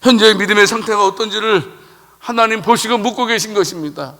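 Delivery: ordinary talk, nothing marked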